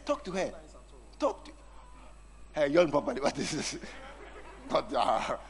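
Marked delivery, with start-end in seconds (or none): none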